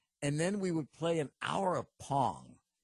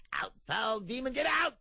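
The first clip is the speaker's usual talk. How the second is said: Ernest Angley impression; 7 semitones higher than usual. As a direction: cast out a demon, building as you go. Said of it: "he'd stick his fingers in their ear and he'd go".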